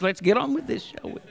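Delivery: low, very soft mutter; imagined impatient listeners. Acted. Preacher voices what some of you are thinking, muttered impatience. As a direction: trail away under the breath. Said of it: "Like some of you are thinking".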